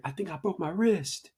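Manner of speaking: put-on stunned boy reporting his injury; lightly voiced hurt kid; injured kid quote. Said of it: "he's like".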